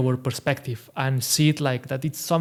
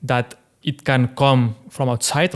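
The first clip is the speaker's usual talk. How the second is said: usual speaking voice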